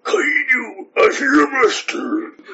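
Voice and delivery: 高く作った怪人の声、ゆっくり伸ばす; アニメの超人を大げさに物真似; アシュラマン. Acted. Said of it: "アシュラマンは。". (芝居がかった怪人の叫び声)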